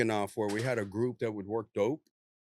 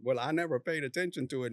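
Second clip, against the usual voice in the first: ordinary talk, nothing marked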